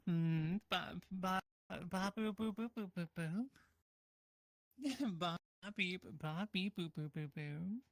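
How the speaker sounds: beep-boop robot chatter, slow and choppy; toy robot voice. Pretend. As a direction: babble like a little toy robot. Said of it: "to talk only in beep boops".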